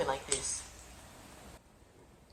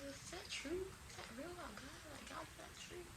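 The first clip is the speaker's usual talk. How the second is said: hushed wondering; 9 semitones higher, 24 dB quieter than usual. Retold or imitated. Retold inner doubting thoughts.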